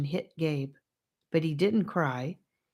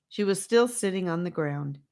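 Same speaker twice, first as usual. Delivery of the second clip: ordinary delivery, nothing marked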